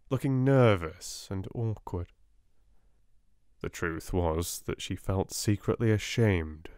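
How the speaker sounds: plain reading voice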